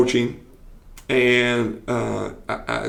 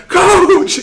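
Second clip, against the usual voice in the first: high, loud cry of coach; mock scream; 9 dB louder than usual. Stung player screaming at his coach. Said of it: "He starts screaming at me".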